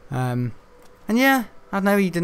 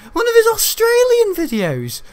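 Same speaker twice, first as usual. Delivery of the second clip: slow, very high, loud outcry; high mock shout; 6 dB louder than usual. Gamer repeating himself about Australian videos, high mock shout. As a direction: squeal it out, drawn out and loud.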